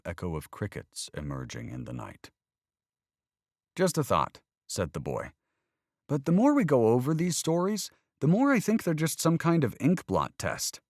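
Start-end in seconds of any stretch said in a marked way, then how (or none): 3.8-4.4 put-on higher, louder earnest boy questioning faith; young boy arguing about God, higher and louder; voiced young boy. A narrator's reading. 6.1-10.8 put-on higher, louder earnest boy questioning faith; young boy arguing about God, higher and louder; voiced young boy. A narrator's reading.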